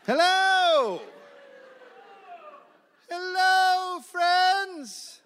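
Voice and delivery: high, loud calling out across the snow; loud hello. Acted. Rescuers calling to the camp, high loud hello. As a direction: hail them from far off. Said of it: "We raised a loud hello".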